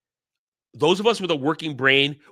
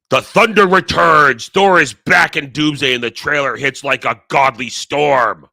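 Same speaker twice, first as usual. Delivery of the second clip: mock epic trailer read; 8 dB louder than usual. Shown as a demonstration. Reading the leaked trailer post aloud, booming trailer voice. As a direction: boom it out like a movie trailer. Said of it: "I will read it to you".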